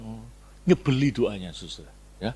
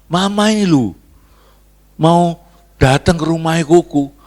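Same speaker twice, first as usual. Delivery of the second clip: louder, lively voice of a sibling on the phone; phone-call announcement; 3 semitones higher, 9 dB louder than usual. Acted. Sibling phoning about mama's visit, acted-out loud announcement. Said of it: "Adiknya telepon".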